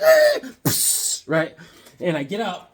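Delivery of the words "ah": very high, loud yelp of alarm; stopping the truck in alarm; mock yelp. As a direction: shriek in surprise. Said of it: "I'm like".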